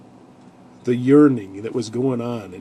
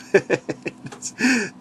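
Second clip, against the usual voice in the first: high delighted laugh; 11 semitones higher than usual. Real, not acted. Laugh after voicing Joseph.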